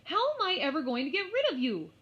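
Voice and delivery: high, loud fed-up cry; exasperated shout. Acted. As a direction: yell it in despair at the lamb. Mary exasperated at lamb.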